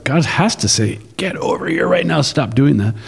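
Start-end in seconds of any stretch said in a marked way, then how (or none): 1.2-2.9 stern parent calling. Retold or imitated.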